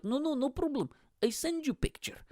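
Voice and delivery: high-pitched, breezily insistent dad voice; imitated dad. Dad reassuring his embarrassed child about the picture, high imitated voice. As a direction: play dad brushing off objections way up high.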